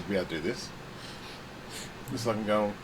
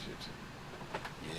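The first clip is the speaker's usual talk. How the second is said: whispered curse; 9 semitones lower, 19 dB quieter than usual. Real, not acted. Whispered curse at bad news.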